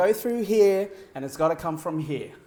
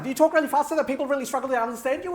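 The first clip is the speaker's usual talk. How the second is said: rapid-fire, higher-pitched demo; rushed, tumbling pace; really fast talk; 6 semitones higher, 4 dB louder, much faster than usual. Shown as a demonstration. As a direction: race through it without a breath. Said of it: "what's your pace like?".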